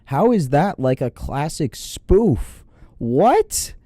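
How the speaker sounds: slow, low, word by word; stunned disbelief. Real, not acted. Vlogger hearing a killing told as funny, stunned.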